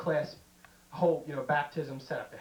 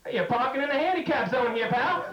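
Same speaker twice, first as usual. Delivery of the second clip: tough cop; 6 semitones higher, 4 dB louder than usual. Retold or imitated.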